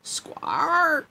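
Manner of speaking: high, long parrot squawk; animal cry. Acted. Parrot announces itself with the lamp, high squawk. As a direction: screech out like a parrot. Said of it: "A strange cry from some kind of animal rung out".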